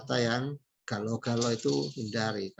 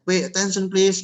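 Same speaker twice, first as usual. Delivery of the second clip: loud, higher call to get the room's attention; louder, higher attention call; 6 semitones higher, 7 dB louder than usual. Shown as a demonstration. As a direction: call out over a chatty audience, raised and louder. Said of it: "gitu ya".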